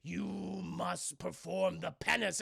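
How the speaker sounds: hammed-up demon voice. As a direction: intone it like a commanding demon. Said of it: "turns into a fucking demon and goes".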